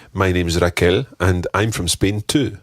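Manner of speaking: normal talking voice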